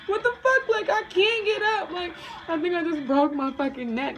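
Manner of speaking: very high, loud mock-crying panic; crying wail. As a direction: re-enact a tearful, shrieked complaint. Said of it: "I'm crying 'cause I cannot move".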